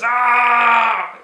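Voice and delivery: a big hammed-up yell; mock scream. Mocking the hero's big movie scream. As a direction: bellow like the hero lifting the block. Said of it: "he screams, he goes".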